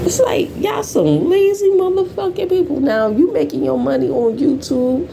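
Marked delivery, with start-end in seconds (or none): 0.0-2.8 much higher, fed-up disbelief; high, incredulous rant; exasperated high outburst. Real, not acted.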